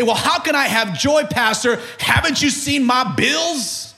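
high-pitched plea to the pastor; exasperated congregant making excuses; complaining churchgoer. Acted. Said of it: "we often say".

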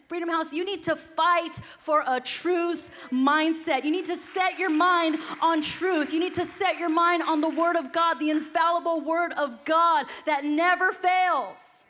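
loud, high, rising sermon climax; loud, high revival preaching; preaching at full force. Acted. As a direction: build the crowd up with each line.

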